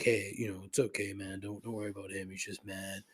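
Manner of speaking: retold peacemaker, quietly reassuring; hushed placating. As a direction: play down the trouble in a quiet voice. Retold friend calming things down.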